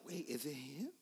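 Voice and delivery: soft, wondering aside; quiet retold disbelief; hushed reaction. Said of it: "we were all like".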